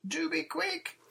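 very high, loud plea; shouted. A narrator's reading. Peggy shouting down to the boat, high and loud. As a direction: shout it shrilly to hurry them. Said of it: "shouted Peggy".

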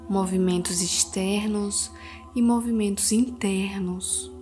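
soothing spa whisper. Acted. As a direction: guide them gently into calm. Leads a guided meditation. (voz suave de meditação guiada)

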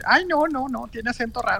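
high, whiny mock complaint; mock fussy complainer. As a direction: imitate someone fussing about accents.

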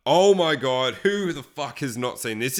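ordinary delivery

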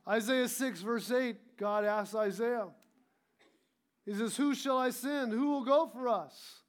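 a preacher's rising call-and-response fervor; full-force preaching. Acted. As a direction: press it home to the congregation.

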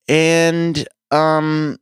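ordinary talk

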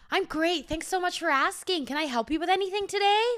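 high, loud, fast gushing salesperson; bubbly clerk pressing to help; over-eager American sales patter; pushy cheerful shop assistant. Retold or imitated.

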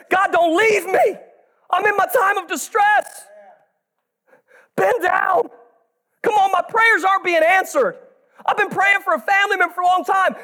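desperate cry. Shown as a demonstration. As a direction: cry out in anguish, full voice. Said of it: "That's the power of this prayer".

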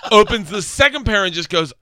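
normal talking voice

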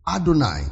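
Adonai said as a-do-NAI, falling at the end; Hebrew word Adonai. Shown as a demonstration. Preacher naming the Hebrew word for the congregation, a-do-nai. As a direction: give Adonai its full Hebrew sound, a-do-nai. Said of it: "it is the word".

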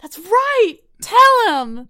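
high, very loud cheer; sports-fan cheering. Retold or imitated. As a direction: yell it like a fan rooting for the team. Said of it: "cheering on my favorite sports team".

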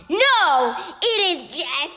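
loud, high dramatic reveal; dramatic exclamation. Acted. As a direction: proclaim it like a trailer.

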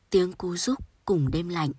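plain reading voice

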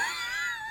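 short high burst of laughter. Real, not acted. Host laughing at his grandmother's escape, high laugh. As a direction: laugh at the punchline.

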